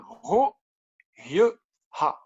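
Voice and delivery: Ro, Yeu, Ha said as separate careful syllables; nom vietnamien approximatif. Real, not acted. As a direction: sound out Ro... Yeu... Ha, pausing between each. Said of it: "pardonnez-moi mon accent". (nom vietnamien prononcé syllabe par syllabe)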